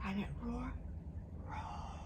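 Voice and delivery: a soft, almost whispered roar; tiny hushed dinosaur roar; quiet roar. Shown as a demonstration. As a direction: shrink the roar down to a hush. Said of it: "very quietly for me".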